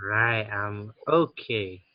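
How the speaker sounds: slow, drawn-out model answer; teacher modelling the phrase slowly. Shown as a demonstration. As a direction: say it slowly for the child to copy. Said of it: "Còn nói I'm okay".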